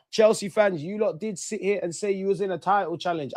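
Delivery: usual speaking voice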